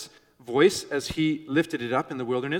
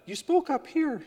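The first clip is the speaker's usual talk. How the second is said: put-on pitched far up, like a young boy; high boyish voice; 12 semitones higher than usual. Shown as a demonstration. Preacher showing how young boys used to speak, high-pitched boy's voice. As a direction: speak right up high, as before the voice changed. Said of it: "you didn't speak in the low voice".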